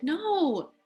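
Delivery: put-on a narrated girl's excited chatter; happy girl. A narrator's reading. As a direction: sound like a thrilled kid on a bike. Girl riding happily.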